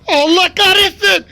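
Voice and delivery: high, loud, slow despairing curse; panicked cursing. Real, not acted. Gamer caught by the monster, cursing in panic. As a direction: curse out in sheer fright.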